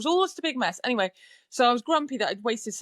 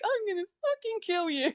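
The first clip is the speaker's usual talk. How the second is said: mock threat; 10 semitones higher than usual. Retold threat after false praise.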